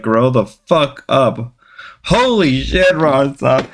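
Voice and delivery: usual speaking voice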